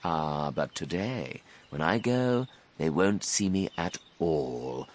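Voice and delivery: soft, slow, scheming crocodile; enormous crocodile. A narrator's reading. Crocodile hinting at his plan, soft and sly. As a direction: confide it quietly, drawing out words. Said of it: "said the enormous crocodile".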